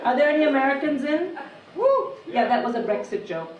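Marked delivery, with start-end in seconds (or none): 1.8-2.1 mock cheer.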